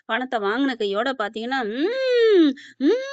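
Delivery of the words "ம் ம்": high, drawn-out possessed hum; trance-like humming wail. Retold or imitated. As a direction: wail a long high "mm" as the spirit descends. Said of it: "சொல்லிட்டு ஒரே sound". (அருள் இறங்கும் நீண்ட உச்சக் குரல் முனகல்)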